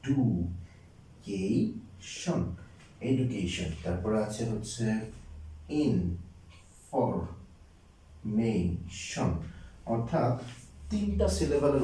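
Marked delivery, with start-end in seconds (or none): 0.1-2.6 ডু, কে, শন as slow separate beats; very slow teacher's breakdown of ডু, কে, শন; syllable by syllable. Shown as a demonstration. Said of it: "এই একবার উচ্চারণ করা যায়". 5.7-9.3 information very slowly, as ইন, ফর, মে, শন; drawn-out teaching breakdown of information; syllable by syllable. Shown as a demonstration. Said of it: "শব্দটাকে ভেঙে ভেঙে".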